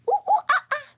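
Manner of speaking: high, hooting monkey calls; monkey sounds. Acted. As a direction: hoot it out like a playful monkey. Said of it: "this animal says".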